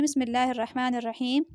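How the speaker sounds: ordinary talk, nothing marked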